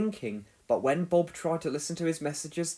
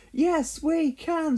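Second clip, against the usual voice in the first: a much higher, chanted reply; team answer; 12 semitones higher, 3 dB louder, much slower than usual. A narrator's reading. The others reply to Scoop, a high cheerful chant. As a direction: answer back as one cheerful crew.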